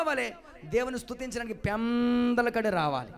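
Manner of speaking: loud, climbing sermon cry; full-force preaching. Acted. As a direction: preach it out to the whole church. (బలంగా ఉత్సాహంగా ప్రసంగం)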